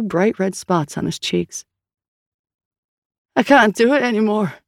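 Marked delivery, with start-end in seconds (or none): 3.4-4.5 strained, higher, louder, on the edge of tears; a worn-out man about to break; high shaking voice. Acted. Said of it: "his voice high and shaking".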